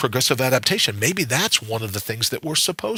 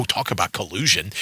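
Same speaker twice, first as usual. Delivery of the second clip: ordinary delivery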